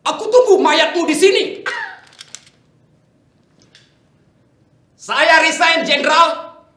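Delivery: yelling a death threat, then quitting in rage; furious shout. Acted. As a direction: scream it with all your fury.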